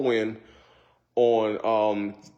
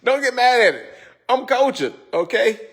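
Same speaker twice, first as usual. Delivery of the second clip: high, louder playful defense; 9 semitones higher, 4 dB louder than usual. Real, not acted. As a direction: tease them to not get mad, pitched high.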